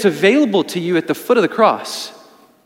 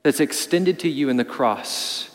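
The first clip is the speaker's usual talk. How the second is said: hushed appeal; 6 semitones lower, 6 dB quieter than usual. Real, not acted. Closing appeal, voice dropping.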